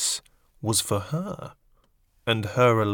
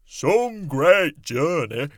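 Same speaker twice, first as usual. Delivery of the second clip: slow, high, weary centipede; 6 semitones higher, 7 dB louder, much slower than usual. A narrator's reading. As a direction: drag it out like a tired bug. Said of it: "the centipede said".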